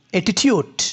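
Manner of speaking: অ্যাটিটিউড, crisp t sounds; careful pronunciation. Shown as a demonstration. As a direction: set out অ্যাটিটিউড as a model word. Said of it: "এটার উচ্চারণ অ্যাটিচিউড, অ্যাটিটিউড দুটাই আছে".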